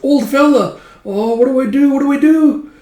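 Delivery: high, loud, panicked squeal; squeaky acted panic; Puck. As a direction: fret frantically as a little elf. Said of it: "you see Puck watching from nearby with".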